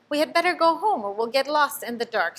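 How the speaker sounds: a worried bird urging the others home. A narrator's reading. Little bird Flap warns friends, high urgent voice. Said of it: "said Flap".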